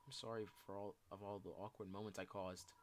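subdued, self-blaming apology; dejected confession. Acted. Shy person apologizing for past awkward moments, dejected confession. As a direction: confess old regrets in a low-key, downcast voice.

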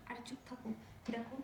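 whispered hush; urgent whisper. Acted. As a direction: hiss it so the guests won't hear. Hushing husband, guests nearby. (অতিথি শুনে ফেলবে ভয়ে স্বামীকে ফিসফিস করে থামানো)

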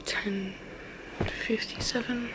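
soft unvoiced whisper, hushed. Real, not acted. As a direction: whisper so nobody in the house hears. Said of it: "'cause I have to whisper".

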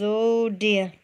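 plain reading voice

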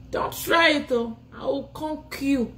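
wag a finger at him with your voice; playful warning; mock threat. Threatening the rival.

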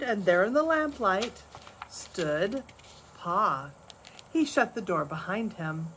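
plain reading voice

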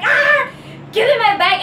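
high, loud angry yell; furious shout. Retold or imitated. Yelling at the man who grabbed her bag, furious. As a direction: shout him down to get the bag back. She snaps at bag thief.

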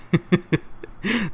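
high giggling laugh; light amused laugh; laughter. Real, not acted. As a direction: burst out laughing. Listener laughs at story.

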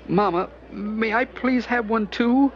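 put-on high, small child's voice; a hungry little boy begging his mama. A narrator's reading. Little boy asking his mother for cake, high child's voice.

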